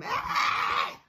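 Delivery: retold scream at full volume; loud yell. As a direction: let out a loud yell for the story. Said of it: "I've never yelled louder".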